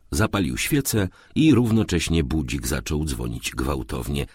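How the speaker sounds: usual speaking voice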